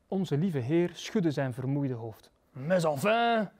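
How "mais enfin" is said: exasperated, drawn-out groan; mild moaning exasperation. A narrator's reading. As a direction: groan it in weary protest. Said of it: "kreunde hij".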